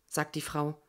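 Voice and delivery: ordinary delivery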